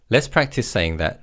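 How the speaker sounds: usual speaking voice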